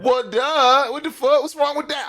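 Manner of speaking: high-pitched, exaggerated indignation; mock outrage. Reactor jokingly objects to a horsepower figure, mock outrage. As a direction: squeal in pretend disbelief.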